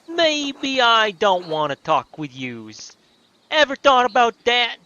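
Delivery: put-on high, loud, stretched-out Brooklyn accent; hammed-up Brooklyn brush-off; terrible Brooklyn accent. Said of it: "This is a terrible Brooklyn accent".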